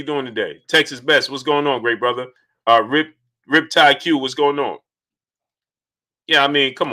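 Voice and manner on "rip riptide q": normal talking voice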